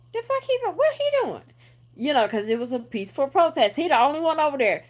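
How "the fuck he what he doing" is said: high, startled voice; incredulous bystander. Retold or imitated. Onlookers at the protest asking what he's doing, in a high incredulous voice. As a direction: squeak out the disbelief. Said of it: "they're like".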